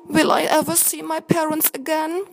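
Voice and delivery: pleading cry. Acted. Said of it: "cried Maxim".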